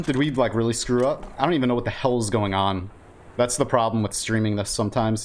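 ordinary talk

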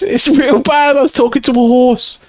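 suddenly high, squeaky voice; squealed protest. Acted. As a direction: pitch it way up, squealing. Squeals about talking to a horse.

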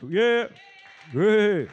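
loud, high whoops of encouragement; cheering. Real, not acted. Host welcomes a speaker with applause, loud cheering. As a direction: cheer her up to the mic.

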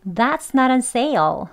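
That's not on sale very slow, stress on not; slow sentence, stress not; native linking. Shown as a demonstration. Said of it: "이렇게 발음하게 됩니다". (아주 천천히 not 강세)

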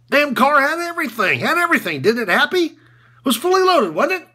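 high, pushed, goading delivery; worked-up, high-pitched needling; heated sarcastic rant. Acted. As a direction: rub it in, voice riding high. Coach's sarcastic tirade at press conference.

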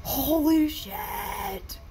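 drawn-out shock. Real, not acted. Watcher learning it is not done yet, long astonished exclamation. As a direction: drag the words out, truly stunned.

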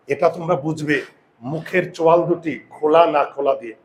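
ordinary talk

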